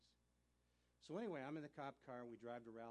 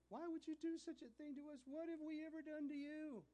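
mother's plea; 14 semitones higher, 4 dB louder than usual. Retold or imitated. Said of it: "just wailing".

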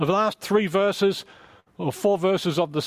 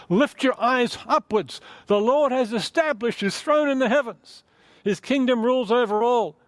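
scripture reading; 6 semitones higher than usual. Acted. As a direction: lift the words up like a call to look up. Reads verse nineteen aloud.

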